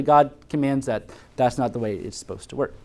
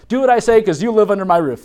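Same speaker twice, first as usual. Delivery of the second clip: bossy parent; 6 semitones higher, 6 dB louder than usual. Retold or imitated.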